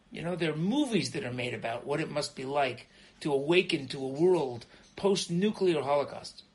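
normal talking voice

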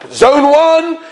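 high, proud boarding call; self-important announcer. Retold or imitated. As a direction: imitate an airport official relishing her power. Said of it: "she held herself to be like the president".

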